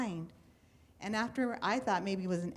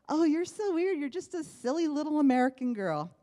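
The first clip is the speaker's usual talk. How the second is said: higher, louder playful scoff; teasing retort; 6 semitones higher, 4 dB louder than usual. Retold or imitated. Girl retorts to teasing cousins in Mexico, playful higher scoff. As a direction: scoff back at them, half laughing.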